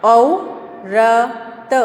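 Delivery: औरत drawn out very slowly; teacher drawling औरत slowly; slow stretched reading. Shown as a demonstration. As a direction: stretch औरत syllable by syllable for a child. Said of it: "यहाँ देखिए".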